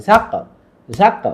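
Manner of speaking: জাক with a light, short bounce on the final k; normal light qalqalah. Shown as a demonstration. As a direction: say জাক easily, a small echo after k. (জাক-এর শেষে হালকা ধাক্কা)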